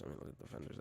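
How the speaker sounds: unvoiced whisper while browsing; whisper to self. Real, not acted. Looking through the game menu, whispered to himself. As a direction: breathe it to yourself.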